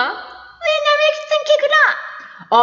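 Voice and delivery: very high, slow drawn-out woman's voice; mimicking a girl. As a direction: stretch the mock falsetto out slowly. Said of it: "꾀꼬리 같은 소리".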